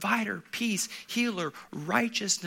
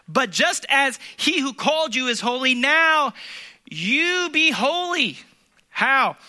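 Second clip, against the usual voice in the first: louder, slow, building preacher's cry; preaching at full force; 9 semitones higher, 7 dB louder, slower than usual. Acted. Preacher urging his congregation, building to a loud slow cry. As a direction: press the point home to the congregation.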